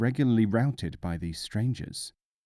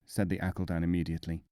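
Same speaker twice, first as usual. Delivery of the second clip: usual speaking voice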